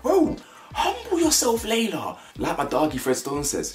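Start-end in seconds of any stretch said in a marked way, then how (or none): 0.0-2.2 high-pitched, drawn-out telling-off; incredulous high-pitched rebuke; exasperated scolding. Real, not acted. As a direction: scold her with a long, high wail. Scolding an absent contestant directly.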